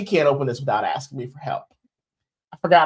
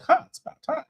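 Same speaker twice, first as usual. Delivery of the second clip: much higher, self-pleased mock smugness; smug satisfaction. Husband retelling his reaction to being called the boss, mock smug. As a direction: gloat as if you finally won. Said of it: "And I was like".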